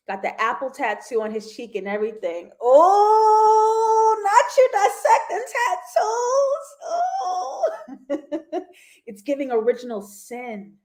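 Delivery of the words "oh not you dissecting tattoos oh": high, loud laughing exclamation; real delighted laughing shriek; laughing squeal. Real, not acted. Laughing at viewers' tattoo theories.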